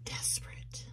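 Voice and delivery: hushed whisper. Real, not acted. Reader names what the card is giving, whispered. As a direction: let the word out in a bare whisper.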